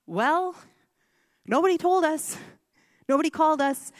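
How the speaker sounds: retold indignant outburst. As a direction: push back at the doctor in disbelief. Indignant reply to doctor.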